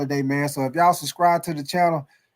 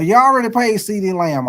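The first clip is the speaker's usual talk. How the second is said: reporter cutting in; 5 semitones higher, 5 dB louder than usual. Retold or imitated. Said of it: "interviewer corrects Jerry Jones".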